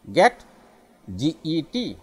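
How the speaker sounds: normal talking voice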